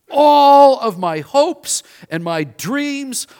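loud, high, slow lament; despairing cry. Retold or imitated. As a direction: wail over lost dreams. Simon's despair imagined.

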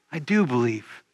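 soft, low, hushed repeat; a quiet, reverent echo of the confession. Shown as a demonstration. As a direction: let it sink in, almost to yourself. Repeating Martha's confession, softer.

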